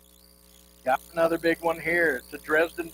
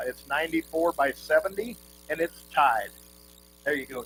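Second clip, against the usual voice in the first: ordinary talk, nothing marked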